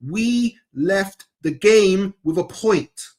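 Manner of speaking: slow, emphatic point-by-point delivery; really slowly. Shown as a demonstration. Fan spelling out his argument to his debate partner, slowly. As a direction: lay out each clause slowly and deliberately. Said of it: "I'm gonna say it really slowly".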